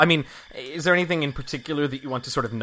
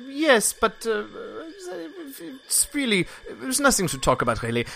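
much higher, lightly voiced evasive woman; evasive NPC woman; 11 semitones higher than usual. NPC woman dodging the party's question, higher and evasive. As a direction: brush the question off.

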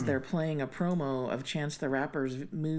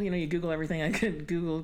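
normal talking voice

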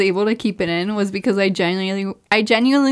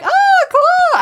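much higher, airy forced nonchalance; fake casual squeak; 5 dB louder than usual. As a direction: play it cool in a tiny voice. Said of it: "I'm like".

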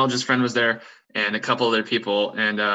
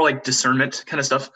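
ordinary delivery

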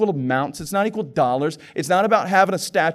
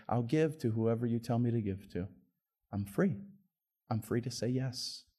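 surrendered prayer; 5 semitones lower, 8 dB quieter than usual. Acted. Prayer voiced as surrendered believer.

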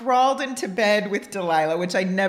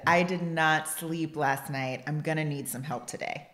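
low, slow, softer restatement; calm, measured; 7 semitones lower, 6 dB quieter than usual. Retold or imitated. Wife re-enacting her own words to correct husband, calm and measured. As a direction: set the record straight with quiet deliberateness.

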